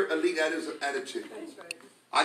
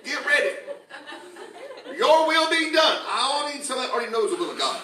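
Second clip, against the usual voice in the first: higher, louder revival preaching; full force preaching; 8 semitones higher, 5 dB louder than usual. Acted. Pastor fires up the congregation, preaching louder and higher.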